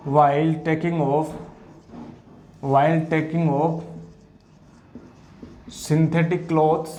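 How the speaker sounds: drawn out word by word; slow dictation. Shown as a demonstration. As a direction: spell it out slowly so they can write. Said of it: "dictating notes slowly".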